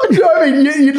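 laughing so hard the words squeak out. Real, not acted. Friend laughing while picturing Maddie stomping at the camera.

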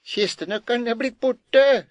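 high, loud panicked shriek; wife screams. A narrator's reading. Wife hiding the priest shrieks in panic. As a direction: shriek it out in alarm. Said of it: "skrek kjerringa".

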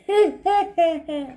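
a high laugh; mocking laugh. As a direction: giggle at your own joke. Petey laughing.

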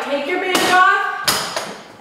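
usual speaking voice

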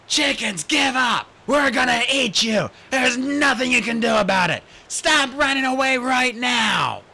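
loud, high, slow yelling at chickens; big frustrated bellow; desperate shout. Acted. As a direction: shout them into surrender.

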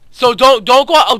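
ordinary talk

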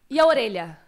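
ordinary delivery

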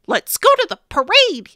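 high, loud eager voice; excited suggestion. Acted. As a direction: pitch the idea with glee. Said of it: "he said".

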